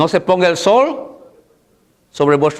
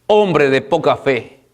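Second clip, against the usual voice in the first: ordinary delivery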